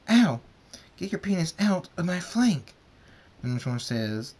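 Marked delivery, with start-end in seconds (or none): 0.1-0.4 sharp high cry of pain; sudden high ow; pained yelp. Acted. Rainbow Dash hurt during mating. 1.0-2.7 high, loud protest; pained high outcry; indignant yell. Acted. Rainbow Dash protesting in pain.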